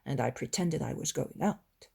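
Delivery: usual speaking voice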